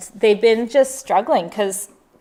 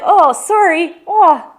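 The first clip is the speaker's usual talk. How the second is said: high, loud New Zealand apology; rough attempt at a New Zealand accent; 8 semitones higher, 8 dB louder, much slower than usual. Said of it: "that's how they talk".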